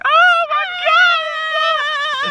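very high, loud squealing shriek; mock girly scream. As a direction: shriek it like an overexcited choir girl. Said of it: "go screaming".

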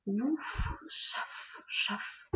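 soft, airy chuffing, mostly whispered; breathy train puffs; strange train sound. A narrator's reading. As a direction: imitate a distant train puffing slowly. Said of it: "by a strange sound".

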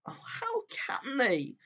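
slow, high, breathy whisper through tears; tearful whisper. A narrator's reading. Lucy watching Aslan mocked, whispering through tears. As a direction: whisper it while crying softly. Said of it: "tears streaming down her cheeks".

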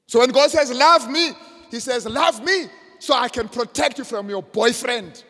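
loud, high declaration from the pulpit; full-force preaching. Acted. Preacher declaring God's promise to the congregation, full-force preaching. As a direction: proclaim it to the congregation.

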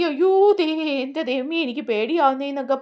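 frightened cry. Retold or imitated. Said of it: "പറഞ്ഞ് കാറുക".

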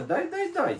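put-on high-pitched plea modelled for a child; prompting a child. Shown as a demonstration. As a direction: show a little one how to ask, pitched high. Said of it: "Скажи".

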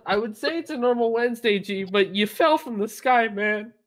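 ordinary talk

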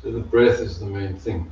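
ordinary delivery

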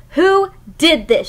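half-hearted angry shout; mock shout. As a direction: call it out crossly, not too loud. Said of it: "she shouted".

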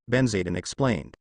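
usual speaking voice

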